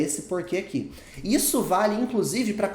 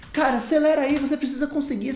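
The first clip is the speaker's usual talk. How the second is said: mock pep talk; 8 semitones higher, 3 dB louder, faster than usual. Teacher cheering himself on.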